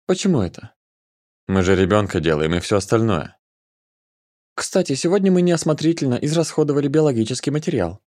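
put-on high, playful girl voice; playful teasing. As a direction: tease him, pitched high. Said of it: "продолжала забавляться".

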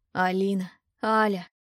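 lightly voiced weary man, a touch raspy; a hint of a tired, raspy male voice. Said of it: "Мужской голос низкий, с усталой хрипотцой".

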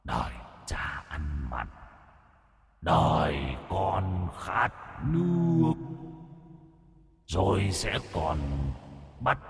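a menacing ghost whispering doom, slow; taunting demon. Acted. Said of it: "Nó cất cái giọng khiêu khích".

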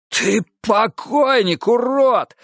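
high, slow hoarse snarl; hoarse threat. A narrator's reading. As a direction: wheeze out the threat. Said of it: "хрипел Монахов".

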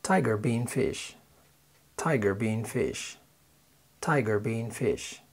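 slow, careful English phrase; slow listening drill. Shown as a demonstration. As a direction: read it slowly so they can hear it. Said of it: "اسمع كده".

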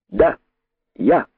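short, high-pitched defiant cries; cheeky exclamation. A narrator's reading. Impudent rabbit owning up to the king, bold exclamation. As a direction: throw each word out boldly, separately. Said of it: "воскликнул дерзкий кролик".

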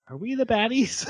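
high, put-on little voice; hammed-up dawning doubt; mock worried question. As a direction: ask it high, like a sidekick having second thoughts. Acts Yuna's worried question.

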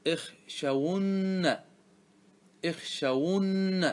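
each syllable drawn out slowly; slow classroom demonstration of the form; teacher's slow model. Shown as a demonstration. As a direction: spell the word out in sound, very slowly. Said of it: "تقول".

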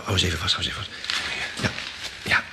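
ordinary talk

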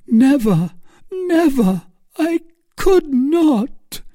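very high, slowing despairing refusal; anguished refusal. A narrator's reading. Milady refusing Felton, very high and despairing. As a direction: break off in shame.

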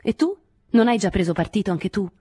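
loud, pointed aggressive retort. A narrator's reading. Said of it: "disse Nadine con voce aggressiva".

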